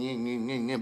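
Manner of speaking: soft buzzing "yin, yin, yin"; low-volume humming engine imitation; imitated engine noise. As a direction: imitate a small motor whirring quietly. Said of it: "They gonna hear that engine".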